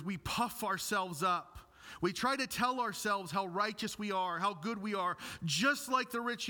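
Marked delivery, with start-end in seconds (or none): none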